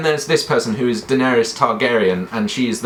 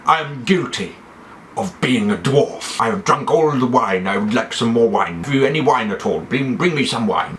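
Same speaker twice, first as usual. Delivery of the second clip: put-on character voice with measured, weighty words; lightly theatrical confession, then asking for wine; Tyrion Lannister. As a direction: play a wine-loving dwarf lord on trial. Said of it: "he talks like this ... perfect impression".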